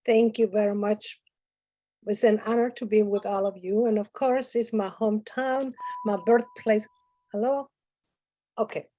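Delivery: ordinary talk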